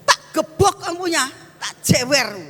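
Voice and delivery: high, sharp threat to pinch ears; mock angry threat. Mother threatening her child with a pinch, mock angry. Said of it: "itu wis pakai emosi loh".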